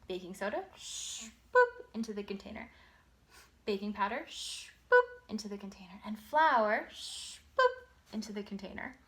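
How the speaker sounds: playful sing-song boop demo; reading to a toddler. Shown as a demonstration. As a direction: make each boop a fun little game. Demonstrating the book to child.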